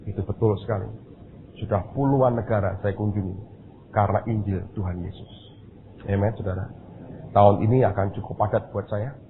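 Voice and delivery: ordinary delivery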